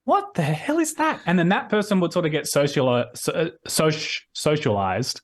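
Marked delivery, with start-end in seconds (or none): 0.0-1.2 much higher, mock-baffled; incredulous. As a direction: react as if hearing something absurd. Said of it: "all of us would kinda go like".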